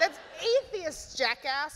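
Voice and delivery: high, loud, slowed indignant correction; furious. A narrator's reading. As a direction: snap it back, outraged. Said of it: "said Simon furiously".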